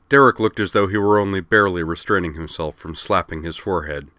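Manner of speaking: plain reading voice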